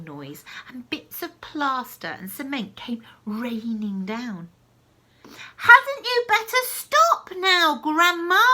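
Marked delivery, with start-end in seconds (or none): 5.6-8.4 very high, loud and slow call; boy calling up in alarm. Acted. George calling to Grandma to stop, loud and high. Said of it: "George called".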